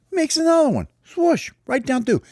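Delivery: excited narration. A narrator's reading. Narrator thrills at the perfect shot to the listener, excited. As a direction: sell the shot with glee.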